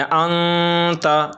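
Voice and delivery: ant with noon held long in the nose; slow, level nasal hum on the n of ant; ikhfa on the noon. Shown as a demonstration. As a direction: linger on the hidden noon. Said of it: "जैसा कि यहाँ पे नून साकिन है".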